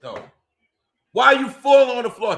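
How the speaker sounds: high, loud frustrated shout; exasperated yell. Real, not acted. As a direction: yell it at the screen, fed up. Yelling at fighter repeatedly.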